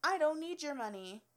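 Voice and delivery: indignant reply; retold retort. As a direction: snap it back at him. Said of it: "And I was like".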